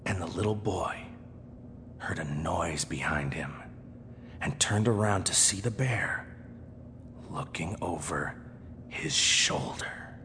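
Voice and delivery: hushed, slow, whispered narration; slow and soft. Shown as a demonstration. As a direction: whisper it slowly to build dread. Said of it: "I could speak slowly and softly".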